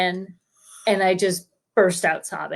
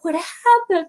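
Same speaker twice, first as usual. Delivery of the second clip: high-pitched worried sister; alarmed concern; 9 semitones higher, slower than usual. Retold or imitated. Sisters alarmed at her sobbing.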